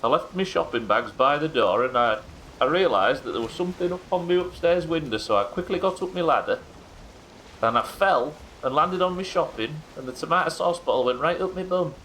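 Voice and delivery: high-pitched Yorkshire excuse-maker; Yorkshire accent. Retold or imitated. Patient blaming a fall from the ladder, Yorkshire accent. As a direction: tell the tall tale in a put-on Yorkshire voice. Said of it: "he's got a Yorkshire accent".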